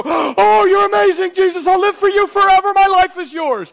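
high, loud, hammed-up adoration; mock rapturous gushing. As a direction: gasp and gush like an overwhelmed new convert. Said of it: "she needs to just go".

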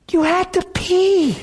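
slow, very high squeak; tearful amazement. Retold or imitated. Driver reminds his crying buddy of the bladder, tearful amazement. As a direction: squeeze it out high, as if through tears.

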